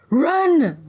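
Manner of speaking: high, loud cry to flee; urgent shout. A narrator's reading. Ziba tells her friend to flee, shouting urgently. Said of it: "Ziba shouted".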